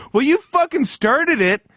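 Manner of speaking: high, loud indignant yell back; retold screaming. Narrator yelling back at his coworker on the plane, high mock shout. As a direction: shout the comeback like a furious grown child. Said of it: "two hosers screaming at each other".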